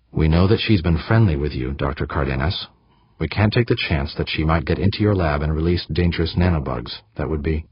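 usual speaking voice

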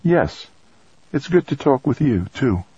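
tinge of sadness. A narrator's reading. Woman ending call with colleague, a touch sad. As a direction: let a little sadness into a high, tender reply. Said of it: "tinge of sadness in it".

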